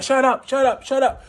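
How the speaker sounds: high, heated mock shout; police order. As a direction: yell over him like an impatient officer. Officers yelling during the beating.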